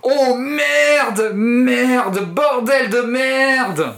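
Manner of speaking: high, loud mock yelling, stretched out; fake scream. Kid pretending to be bitten, loud hammed-up screaming. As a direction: scream it but it's really a joke. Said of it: "se mit à hurler à plein poumon".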